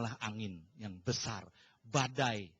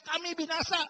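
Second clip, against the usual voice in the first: high-pitched mock cry of doom; imitated screaming. Preacher asking why disciples cried out, imitated screaming. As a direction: mimic the disciples' panicked yell. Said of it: "mereka teriak-teriak".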